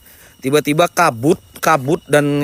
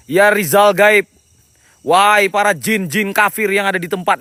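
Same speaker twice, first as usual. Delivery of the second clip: raised, declaiming summoning voice; ritual summoning; 5 semitones higher than usual. Acted. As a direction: call out to the spirits like a shaman. Ghost hunter summoning spirits.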